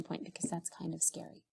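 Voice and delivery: mock scary whisper; playful spooky whisper to children. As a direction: hush it like a spooky secret.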